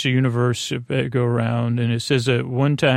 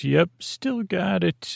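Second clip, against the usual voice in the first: high, slow imitation of a squeak; mock high-pitched reply; voice goes up; 6 semitones higher, 3 dB quieter, slower than usual. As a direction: let the voice jump up high, drawn out. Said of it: "his voice goes up".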